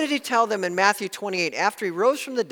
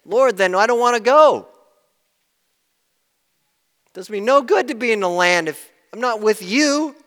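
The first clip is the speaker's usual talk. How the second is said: pleading Moses; 6 semitones higher, 9 dB louder than usual. Retold or imitated. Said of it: "And Moses said".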